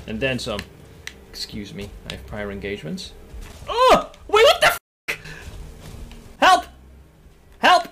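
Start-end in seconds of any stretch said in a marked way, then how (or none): 1.3-3.1 mock-polite exit. Fleeing a fight in game. 3.7-7.9 high, loud cries for help; panicked shouting. Real, not acted. Gamer shouting for help during an attack, panicked.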